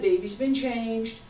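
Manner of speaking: normal talking voice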